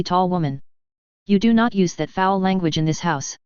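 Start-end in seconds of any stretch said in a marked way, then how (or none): none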